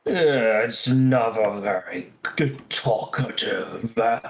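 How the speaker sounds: lightly voiced booming creature; a hint of a big booming giant. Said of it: "A booming voice comes out".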